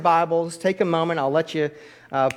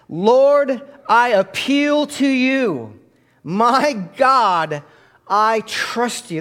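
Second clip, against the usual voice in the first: slow, loud, raised prayer said with the congregation; leading a prayer; 8 semitones higher, 7 dB louder, much slower than usual. Shown as a demonstration. Pastor leading the church in a group prayer, slow and loud. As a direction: pray it out so the church can follow. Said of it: "Say this out loud with me".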